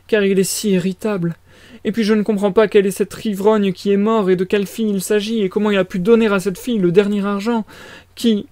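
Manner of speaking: high-pitched flustered mother; fretful mother character. Acted. Voices the mother.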